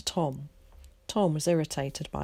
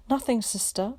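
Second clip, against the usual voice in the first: shaky; 6 semitones higher than usual. A narrator's reading. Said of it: "said the nurse shakily".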